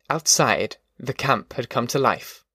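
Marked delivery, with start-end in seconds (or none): none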